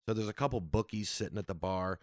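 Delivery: ordinary talk, nothing marked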